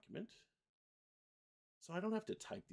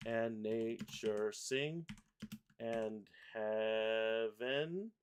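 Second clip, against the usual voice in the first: slow, drawn-out lyric; measured, beat-by-beat reading; syllable-by-syllable reading; much slower than usual. Shown as a demonstration. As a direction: stretch every syllable as it gets pasted. Reads lyrics while pasting syllables.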